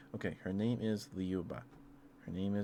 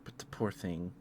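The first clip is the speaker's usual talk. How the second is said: usual speaking voice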